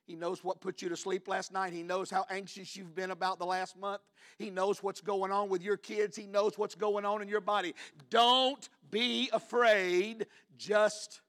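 high insistent preacher's voice, swelling to loud; rising pulpit reassurance; building preaching. Real, not acted. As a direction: build from intense to a slow, loud command.

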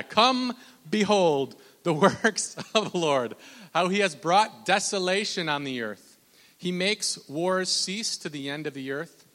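loud opening, slow scripture proclamation; emphatic pulpit reading, gradually settling; preach. Acted. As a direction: proclaim the verse deliberately, weighting key words.

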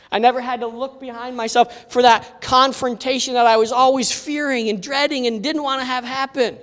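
ordinary talk, nothing marked

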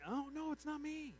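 high-pitched mock protest; mock denial. Peter denying it to the servant girl, high protest. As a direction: protest innocence in a high put-on voice. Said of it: "He denies it".

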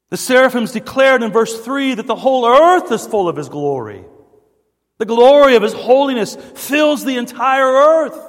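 high, ringing sermon delivery; preaching at full force. Real, not acted. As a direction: proclaim it loudly to the congregation.

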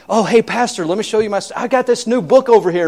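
high, sing-song welcome from an eager host; gushing host. Retold or imitated. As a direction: play the chirpy host showing off. Host eagerly shows book.

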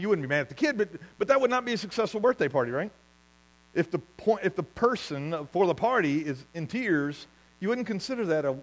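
ordinary talk, nothing marked

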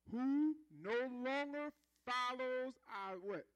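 slow, drawn-out prompt for the crowd to finish; slowly leading the congregation to answer; call for response. Real, not acted. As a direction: hold the question open for them. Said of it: "call and response prompt".

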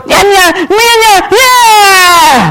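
put-on high baby wail; whining baby talk. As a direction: whine wordlessly like an infant. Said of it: "talking like a".

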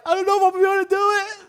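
tearful laughing joy; laughing through the emotion as a new grandfather; emotional laugh. Retold or imitated. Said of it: "And I was like".

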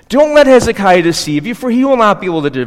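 high, loud herald's cry; loud proclamation. Acted. Spokesman shouting to the people on the wall, loud proclamation. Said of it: "cried out in the loud voice".

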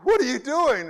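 high, loud disbelieving cry; a hammed-up victim shouting at a lazy cop; shocked outburst. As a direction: blurt it in alarm at the cop. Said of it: "You'd be like".

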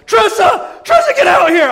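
very high, full-volume yell for help; frantic calling out; panicked shouting. Retold or imitated. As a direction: holler for your wife in a retold panic. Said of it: "I mean, I am panicking".

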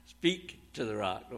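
"Speak to the rock" said softly through a laugh; chuckling repeat. Shown as a demonstration. Preacher to congregation, amused repeat of God's command. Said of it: "What does God say".

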